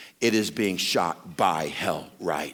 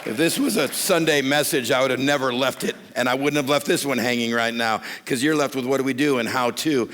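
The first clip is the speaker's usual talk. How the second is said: louder, higher preacher's push; full-force preaching; 5 semitones higher, 5 dB louder than usual. Acted. Pastor presses men on a sermon left hanging, preaching hard. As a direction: drive it home to the congregation.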